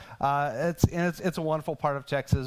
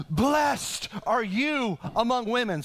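slow, raised voice, proclaiming a blessing; loud exclaiming; 8 semitones higher, 4 dB louder, slower than usual. A narrator's reading. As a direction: call it out in wonder. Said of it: "In a loud voice, she exclaimed".

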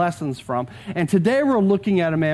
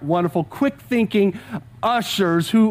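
ordinary talk

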